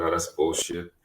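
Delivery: ordinary talk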